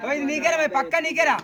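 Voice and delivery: high, urgent calling out; excited call. Real, not acted. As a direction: yell that the catch is his. Shouting at the catching fielder.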